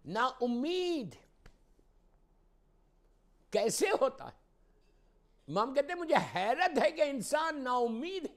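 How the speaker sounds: high, loud sermon proclamation; ringing pulpit delivery, high and loud; impassioned preaching. Real, not acted. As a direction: proclaim it at full force to the congregation. Preaches at full force.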